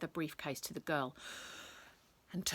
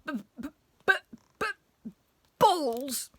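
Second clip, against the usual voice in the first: stuttered b's bursting into a high loud word; struggling to get a shameful word out; reluctant stammer; 9 semitones higher, 6 dB louder, slower than usual. A narrator's reading. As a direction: stammer on the first sound, then blurt it out. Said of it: "could barely bring himself to say".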